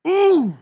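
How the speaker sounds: loud drawn-out mock groan of frustration; frustrated "ooh". Acting out someone mad they got caught crying, loud frustrated ooh. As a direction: let out a big, hammed-up "ooh".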